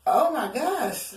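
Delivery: slow drawn-out gasp of surprise; slow hammed-up oh my gosh; mock shock. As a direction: stretch it out, scandalised. Mock shock at own guess.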